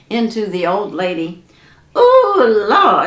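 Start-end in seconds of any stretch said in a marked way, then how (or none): 2.0-3.1 hollering. Acted. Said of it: "she hollered".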